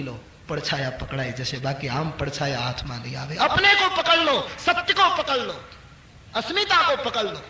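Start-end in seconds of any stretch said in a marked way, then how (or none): 3.4-7.4 higher and louder, pressing each call; fervent exhortation. Real, not acted. Preacher urges listeners to hold on to truth, fervent raised voice. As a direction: drive the call home like a rallying cry.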